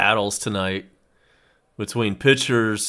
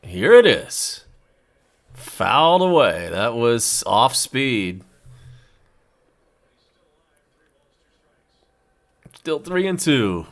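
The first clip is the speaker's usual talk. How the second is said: play-by-play; 2 semitones higher than usual. Acted. Live baseball play-by-play call.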